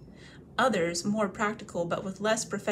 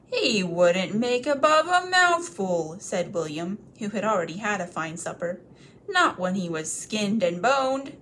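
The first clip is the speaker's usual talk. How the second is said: dismissive troll; 5 dB louder than usual. Acted. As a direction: dismiss the little morsel.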